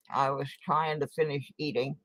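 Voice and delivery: usual speaking voice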